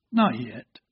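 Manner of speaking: low, soft, smiling reply; gentle. Acted. Potter gently answering the pleading teacup, low and soft. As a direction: let it drop gently and quietly. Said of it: "He smiled gently and said".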